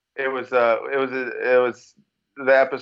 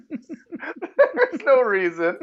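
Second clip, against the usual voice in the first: laughing; 9 semitones higher than usual. Real, not acted. Laughing at the joke.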